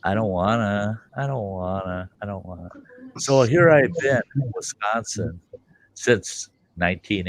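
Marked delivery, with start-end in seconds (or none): 0.0-2.7 mock whine that fades to almost nothing; a childish I don't wanna, trailing off; whiny refusal fading. Husband whining to himself about moving back, softer each time.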